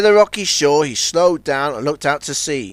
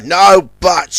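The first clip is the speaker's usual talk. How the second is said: short louder snap; snapping; 3 semitones higher, 7 dB louder than usual. A narrator's reading. Johnny Cuba snapping at Zebedee, louder. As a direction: cut him off sharply. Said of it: "snapped Johnny".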